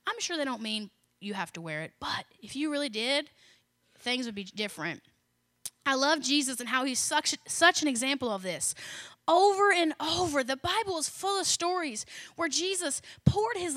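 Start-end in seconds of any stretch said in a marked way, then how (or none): none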